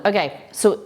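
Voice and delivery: ordinary talk, nothing marked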